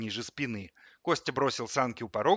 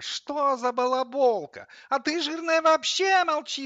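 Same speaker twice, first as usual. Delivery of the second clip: very high, loud, worked-up wail; howling; 6 dB louder than usual. A narrator's reading. Coffee grinder insults the microwave, high indignant howl. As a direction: howl it indignantly, winding up higher. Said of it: "взвыла кофемолка, заводясь".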